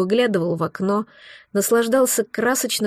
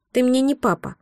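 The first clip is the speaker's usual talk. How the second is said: dry; 3 semitones higher than usual. Acted. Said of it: "сухо сказал сын".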